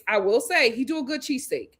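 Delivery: ordinary talk, nothing marked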